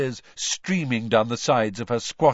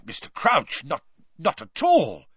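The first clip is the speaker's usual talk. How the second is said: flustered, higher-pitched protest; horrified stammer; 6 semitones higher, 6 dB louder than usual. A narrator's reading. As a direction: stumble over a hasty denial. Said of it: "Amos Diggory looked horrified".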